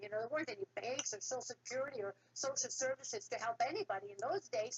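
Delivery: usual speaking voice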